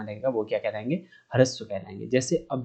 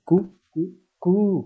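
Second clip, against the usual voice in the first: rooster call; 6 semitones higher, much slower than usual. Retold or imitated. Teacher imitating a rooster crowing to show vowel length. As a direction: mimic a rooster's crow. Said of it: "जैसे मुर्गा होता है ना".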